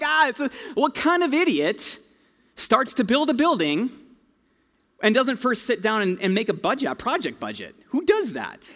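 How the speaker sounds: high, incredulous scoffing at the fool; mocking disbelief. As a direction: scoff like it's the dumbest idea ever. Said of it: "And Jesus is like".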